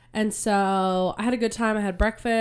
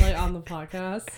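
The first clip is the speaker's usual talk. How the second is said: hoarse from a cold; raspy sick voice. Real, not acted. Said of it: "It's, like, raspy".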